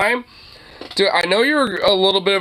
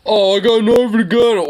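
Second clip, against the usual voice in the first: mocking sing-song repeat, a bit louder and higher; mocking parrot; 4 semitones higher, 4 dB louder than usual. Streamer mocks the bragging filmer's threat, sneering. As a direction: parrot his threat back to ridicule it.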